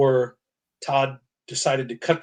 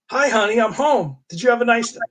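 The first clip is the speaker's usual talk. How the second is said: higher, louder hammed-up hubby hello; cheerful husband greeting; 7 semitones higher, 5 dB louder than usual. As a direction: call it out like a sitcom dad coming home. Husband greets wife, voiced from comic.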